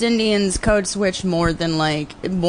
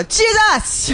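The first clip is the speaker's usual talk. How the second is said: much higher, yelled Jamaican "Jesus!"; Jamaican exclamation. Retold or imitated. Imitating friend Enrique, Jamaican yelled exclamation. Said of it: "he would just go".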